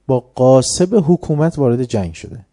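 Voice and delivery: ordinary delivery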